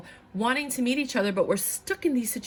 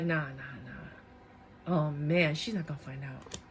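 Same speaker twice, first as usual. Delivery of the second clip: low dismissive mutter; 5 semitones lower, 5 dB quieter than usual. Retold or imitated. Partner voiced low, dismissive.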